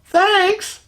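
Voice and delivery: high, sarcastic thanks; dry thanks. Retold or imitated. Kevin thanking the lady for twenty dollars, wry. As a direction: thank her with a wry edge. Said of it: "he's like".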